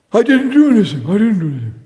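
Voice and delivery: high, put-on voice of a frightened citizen, fading on the repeat; mock panic in front of the police; scared denial.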